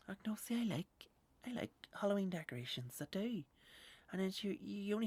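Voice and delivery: lightly voiced high-pitched decorations lover; Halloween fan.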